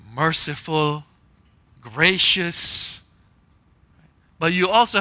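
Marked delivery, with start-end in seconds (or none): none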